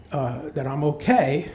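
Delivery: plain reading voice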